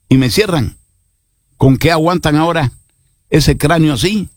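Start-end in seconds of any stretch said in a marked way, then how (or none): none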